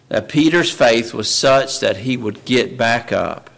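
ordinary delivery

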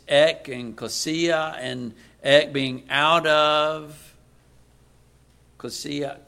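"ek" and "klesia" said slowly as separate parts; Greek word split. Shown as a demonstration. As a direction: break "ek" and "klesia" apart, slowly. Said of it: "The term there in the Greek is ekklesia".

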